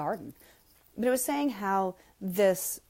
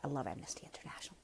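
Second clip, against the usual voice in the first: a quick, soft whisper to the side; whispered aside; 7 semitones lower, 14 dB quieter, faster than usual. Real, not acted. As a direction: slip it in as a secret. Quiet aside after moving on.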